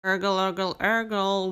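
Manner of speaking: gurgling tummy noise; rumbling tummy sound; stomach rumble. A narrator's reading. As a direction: make a hungry belly gurgle. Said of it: "went the duckling's stomach".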